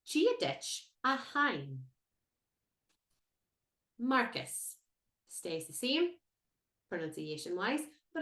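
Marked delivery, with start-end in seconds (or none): 0.0-1.8 slow, careful Irish "Gia dich a hain"; Irish greeting demo. Shown as a demonstration. Teacher to learners, slow model Irish greeting. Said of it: "we say".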